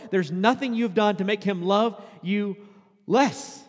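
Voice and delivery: loud, high passionate sermon; preaching at full force. Acted. Pastor urging listeners to rest in God's grace, fervent. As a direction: plead with them to rest in grace.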